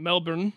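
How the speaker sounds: "Melbourne" with the r sounded; American pronunciation. Shown as a demonstration. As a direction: say "Melbourne" slowly with a clear r. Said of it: "what you and I would call Melbourne".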